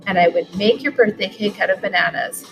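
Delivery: ordinary delivery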